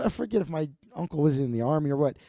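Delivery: normal talking voice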